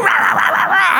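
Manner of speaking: wordless furious sputtering, hammed-up; mock rage gibberish. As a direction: sputter nonsense like a tantrum. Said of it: "scream and throw a fit".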